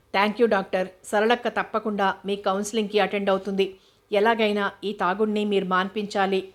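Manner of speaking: plain reading voice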